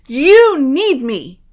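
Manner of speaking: loud, slow, drawn-out warning; ominous boom. A narrator's reading. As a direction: loom over him. Message on the mirror.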